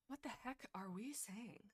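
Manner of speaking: hushed whisper to herself. Real, not acted. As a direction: breathe it out under her voice. Reading chat aloud on stream.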